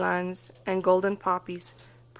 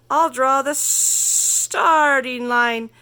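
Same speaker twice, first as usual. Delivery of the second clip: slow, louder snake hiss; hissing Rattlesnake; 3 semitones higher, 5 dB louder, much slower than usual. Acted. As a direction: stretch the sibilants like a snake. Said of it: "hissed Rattlesnake".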